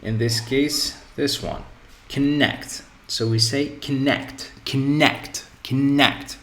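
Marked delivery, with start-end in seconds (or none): 2.1-2.8 "connect" with a light first syllable and strong second; stress on second syllable. Shown as a demonstration. Teacher pointing out this verb, second syllable of "connect" stressed. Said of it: "stress is on the second syllable". 3.8-6.4 "connect" with a short first syllable and punched second; stress on second syllable. Shown as a demonstration. Teacher drilling the verb for learners, second syllable of "connect" stressed. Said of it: "the stress is on the second syllable".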